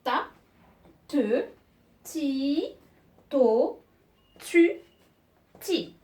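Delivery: one syllable at a time, pauses between; lecture de syllabes. Shown as a demonstration. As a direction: read out each syllable separately like a teacher. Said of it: "Je vais lire". (lecture syllabe par syllabe, bien détachée)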